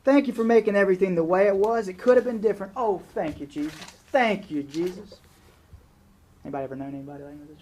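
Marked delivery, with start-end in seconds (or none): 0.0-5.2 loud, fast, high cry of thanks; shouting thanks to Jesus. Retold or imitated. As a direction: shout your gratitude up to heaven, high and loud. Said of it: "I just feel like shouting".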